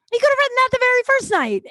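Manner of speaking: very high, loud, rapid protest; indignant outburst. Real, not acted. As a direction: burst out in fast, high-pitched exasperation. Outburst about being stood up.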